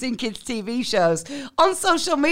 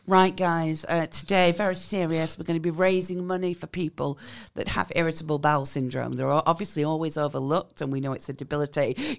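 lower, softer serious influencer voice; earnest charity appeal; 8 semitones lower, 6 dB quieter than usual. Retold or imitated. Creator addresses followers about IBS, mock solemn appeal. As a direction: play the solemn fundraiser.

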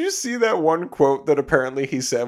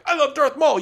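excited fan; 12 semitones higher, 5 dB louder than usual. Retold or imitated. Fan gushing quote.

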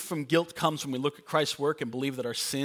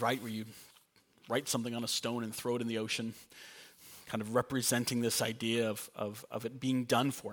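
ordinary talk

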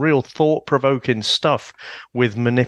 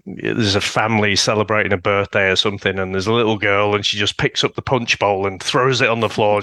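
ordinary delivery

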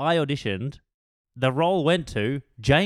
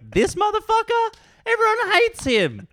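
high, loud indignant outburst; hammed-up outrage, pitched way up; outraged; 5 dB louder than usual. Actor railing about the rival who got the part, outraged. Outraged rant over losing a role.

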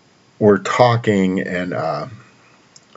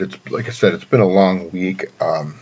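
ordinary delivery

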